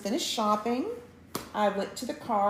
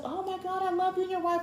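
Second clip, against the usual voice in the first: gushing fan; 11 semitones higher than usual. Retold or imitated. Store clerk recognizing a couple, imitated high gushing. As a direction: imitate someone thrilled to recognize you.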